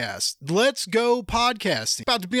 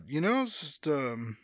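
normal talking voice

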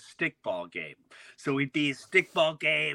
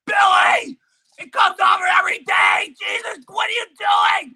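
high, loud, half-sobbing yell at the friend; exasperated yelling; 12 dB louder than usual. Retold or imitated. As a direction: scream in frustration during the game. Angry friend in stickball argument.

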